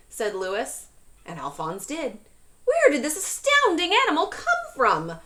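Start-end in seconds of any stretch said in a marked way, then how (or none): 2.6-5.2 high, loud, laughing amazement; astonished cry. A narrator's reading. Librarian cries out in amazement at the animal. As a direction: gasp it out in delight. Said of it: "cried Miss Severs".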